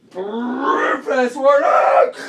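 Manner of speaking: high, very loud drawn-out yell; slow, stretched shout; mock fast-forward shout. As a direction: bellow it like a tape racing ahead. Imitates fast-forwarding noise and shout.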